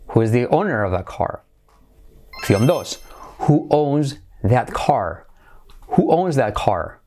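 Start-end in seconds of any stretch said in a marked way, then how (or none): 3.4-5.3 very slow, separated words. Shown as a demonstration. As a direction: model the question slowly for learners. Slow model reading of option. (muy lento, palabra por palabra)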